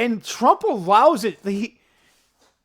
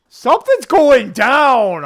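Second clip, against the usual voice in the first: much louder, higher, slow and weighty; emphatic; 6 semitones higher, 11 dB louder, slower than usual. Real, not acted. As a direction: announce it like a revelation. Ominous coincidence conclusion.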